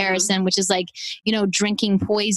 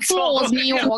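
higher-pitched playful New York imitation; mock New York accent; 4 semitones higher, slower than usual. Joking with co-hosts about the accent slip, mock New York accent. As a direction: play up the New York vowels. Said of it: "That sounded so New York".

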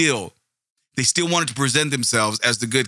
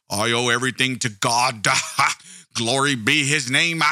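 mock the holy act with stretched-out church fervor; sanctimonious preacher parody; mock pious preacher. Mock pious believer praising God.

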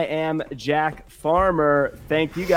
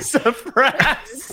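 J R impression; 9 semitones higher, much slower than usual. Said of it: "can't do a J R".

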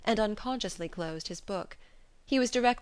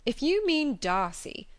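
slow, higher brother's voice; a young man cutting in, drawn out and raised; cried out; 6 semitones higher, 3 dB louder, slower than usual. A narrator's reading. Brother answering his sister, slow and higher-pitched. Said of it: "cried her brother".

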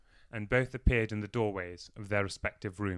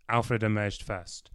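ordinary delivery

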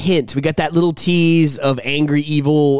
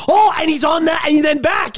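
excited; 9 semitones higher than usual. Real, not acted. Excitedly describing the chase scene.

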